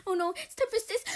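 usual speaking voice

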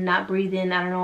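ordinary talk

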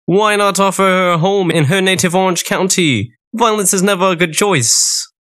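high-pitched character voice. A narrator's reading. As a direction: pitch it well above the narration. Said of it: "Elvis told the queen".

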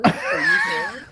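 robot stuck in a loop. Retold or imitated. Teasing his friend by imitating his stuck robot voice. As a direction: squeal it slowly like a broken machine. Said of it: "you were like a robot stuck".

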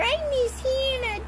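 high mock-sad toy voice; crying bear. Little bear missing Hannah, high pretend crying. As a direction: whine it like a tiny tearful bear. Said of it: "Little bear go".

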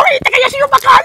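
alto y muy agudo, fanfarrón; gríteselo como un guardia que presume; grito de guardia burlón. Retold or imitated. El tipo presume gritando. (grito burlón de guardia, muy agudo)

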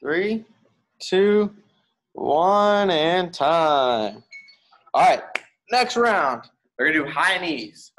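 high, loud countdown; countdown callout. Acted. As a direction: count them down and announce next drill. Coach counting down loudly.

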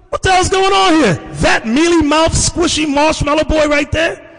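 shouted disbelief. Real, not acted. Pastor reacting to image.

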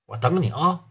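ordinary delivery

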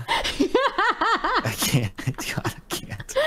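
helpless laughter breaking up the words; real laughing fit. Real, not acted. Player unable to handle co-player's voice, laughing. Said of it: "I don't know if I can handle this".

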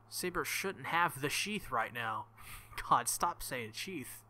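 ordinary delivery

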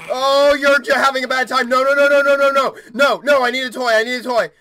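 high, loud, rapid-fire pleading; panicked. Real, not acted. Player pleading with a game creature for a toy, panicked. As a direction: blurt out frantic, loud refusals.

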